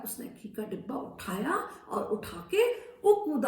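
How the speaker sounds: ordinary delivery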